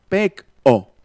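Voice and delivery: "pec-o" with a sharp glottal catch between pec and o; Maya glottal stop. Shown as a demonstration. Teacher shows students the Maya glottal consonant in "pec-o". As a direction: stop the air in the throat on "pec-o". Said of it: "eso que estoy haciendo aquí en la glotis".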